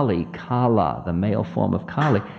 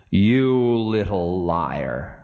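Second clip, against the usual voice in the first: drawn-out taunt; 2 semitones lower, slower than usual. Acted. Ravana taunts Time in quarrel.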